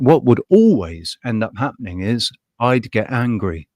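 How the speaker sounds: ordinary delivery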